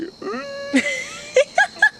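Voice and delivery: high breathy cry-laugh at a movie death; mock sob-laugh. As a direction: laugh like you're about to cry over a hero. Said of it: "I was like".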